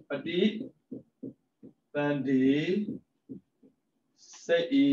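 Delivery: ပဋိ, သန္ဓေ, စိတ်ဤ each said apart with long pauses; syllable by syllable. Shown as a demonstration. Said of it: "split into parts next".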